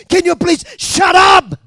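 high, very loud demand for silence; exasperated shout. Acted. Bystander turning on the blind man, exasperated shout. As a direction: shout someone down.

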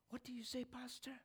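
high and very soft; small, high, tentative voice; hushed question. Acted. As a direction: ask it close up, barely voiced. Man now very close, asks again.

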